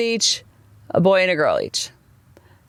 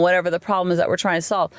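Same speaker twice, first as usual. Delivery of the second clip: ordinary talk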